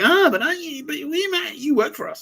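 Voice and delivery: squeaky high mocking voice; mock them in a silly high squeak; mocking the agencies. Vlogger mocking spy agencies in a high squeaky voice. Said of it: "they're all like".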